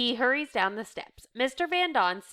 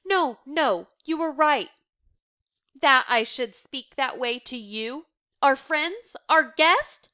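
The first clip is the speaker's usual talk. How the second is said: higher, louder, anguished self-reproach; distressed remorse; 5 semitones higher, 6 dB louder, slower than usual. A narrator's reading. Reader voicing a remorseful woman to her friends, distressed. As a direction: reproach yourself, close to tears. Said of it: "She starts to cry again".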